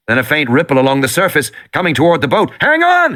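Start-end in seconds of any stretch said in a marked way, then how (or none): 2.6-3.1 much higher, louder shout; loud urgent warning cry. A narrator's reading. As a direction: yell a warning to the kids. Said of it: "he shouted".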